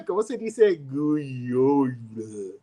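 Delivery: slow, drawn-out gringo Spanish; massacred Spanish, stretched at the end. Retold or imitated. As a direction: labour through Spanish with an American accent. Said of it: "my gringo massacred Spanish".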